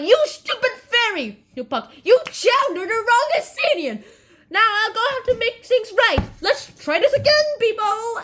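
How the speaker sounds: high, hammed-up telling-off; mock scolding. Oberon scolding Puck over the mistake, mock anger. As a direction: play an exasperated boss berating a bungler.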